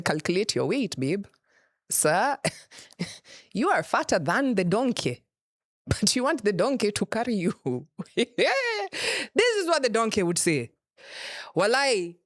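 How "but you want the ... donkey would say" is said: ordinary talk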